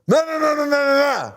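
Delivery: high, strained yell of no's; mimicking a garbled old man's cry. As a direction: shout it like a frantic old man on the trail. Old hiker yelling at her.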